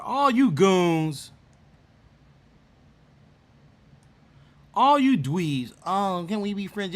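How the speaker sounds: slow playful taunt to the chat; teasing drawl. Real, not acted. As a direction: stretch out each word to tease them.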